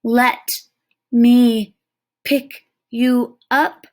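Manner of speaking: put-on boy speaking slowly so the Indian understands. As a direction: spell it out word by word. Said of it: "said very slowly".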